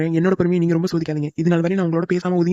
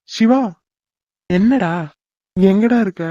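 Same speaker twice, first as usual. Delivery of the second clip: louder, searching shout for a friend; raised, questioning call; 2 semitones higher, 4 dB louder, slower than usual. Acted. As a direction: call through the house for him. Calling out for an unseen friend.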